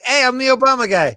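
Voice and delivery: high, loud mocking voice of himself; mock dopey guy voice. Scene: mocking his own pitch to cult members, high loud goofy voice. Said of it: "And I'm like".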